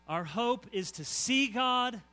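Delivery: slow, high and loud on see; proclaiming. Real, not acted. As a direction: stretch out see God like a cry. Preacher proclaims hope.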